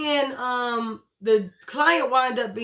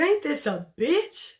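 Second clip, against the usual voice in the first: higher, slow, exasperated disbelief; mock disbelief; 5 semitones higher, slower than usual. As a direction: drag it out like a stunned stripper. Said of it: "that's what [name] looked like".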